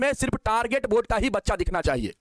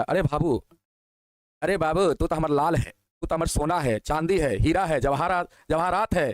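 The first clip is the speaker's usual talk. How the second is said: lower, tender, doting reply; affectionate reassurance; 6 semitones lower than usual. Real, not acted. Teacher reassures his student that he is precious. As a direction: soothe the worried student like a parent.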